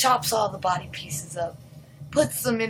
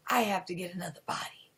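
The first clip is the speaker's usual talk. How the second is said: hushed, fast whisper; 4 semitones lower, 8 dB quieter, much faster than usual. Retold or imitated. Owner whispering his secret plan to himself, hushed. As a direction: hiss the dark plan quietly.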